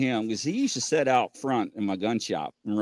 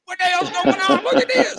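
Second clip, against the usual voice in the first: imitated bystanders; 4 dB louder than usual. Voices filming bystanders in story.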